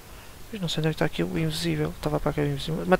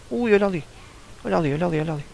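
excited calling out; 2 semitones higher, faster than usual. Real, not acted. Spotting enemy excitedly.

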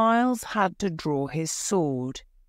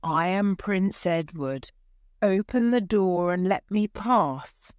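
plain reading voice

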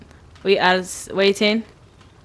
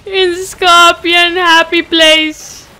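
much higher, louder giddy squeal; 9 semitones higher, 9 dB louder than usual. Pretend. Player squeals giddily swimming in pink Kool-Aid. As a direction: squeal with silly joy.

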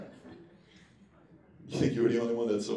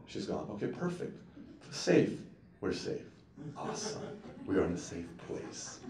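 softer, lower, near whisper; relieved hush; 6 semitones lower, 10 dB quieter than usual. Pretend. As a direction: let the breath out, danger gone. Relieved after temptation passes.

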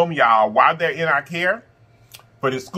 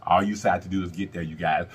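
gentle low aside; 11 semitones lower, 7 dB quieter than usual. Real, not acted. Soft life advice.

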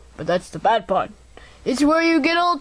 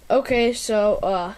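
usual speaking voice

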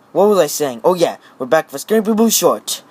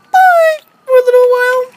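very high, louder toy voice; squeaky toy goodbye; 6 dB louder than usual. Acted. Toy waves goodbye to viewers, very high.

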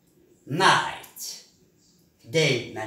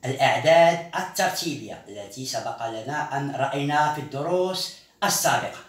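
usual speaking voice

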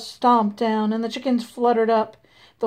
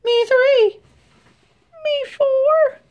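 high, louder chicken chorus with a mock cry; pitched-up chicken voices, half crying; 14 semitones higher, 4 dB louder, slower than usual. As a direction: wail it like frightened hens. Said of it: "said the others".

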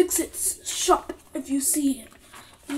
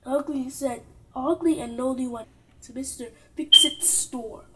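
ordinary delivery

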